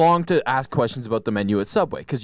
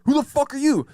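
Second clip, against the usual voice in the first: high-pitched outraged comeback; retold angry yell; 12 semitones higher than usual. Narrator yelling back at the customer, retold angry yell. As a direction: throw the challenge back, pitched way up. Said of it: "me and this guy are yelling at each other".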